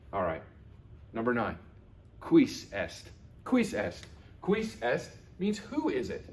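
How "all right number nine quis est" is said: ordinary talk, nothing marked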